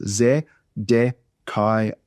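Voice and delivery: "Zedekiah" broken into ze, de, kai, ah; very slow, a gap after each syllable of "Zedekiah"; one syllable at a time. Shown as a demonstration. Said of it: "they're all one syllable sounds".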